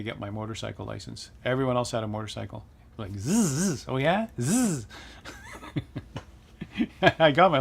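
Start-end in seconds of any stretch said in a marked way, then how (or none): 3.0-3.8 a buzzing zzz like a passing motorbike; playful engine buzz; imitated motorcycle buzz. 4.4-4.9 a higher buzzing zzz of a motorbike; playful engine buzz; imitated motorcycle buzz.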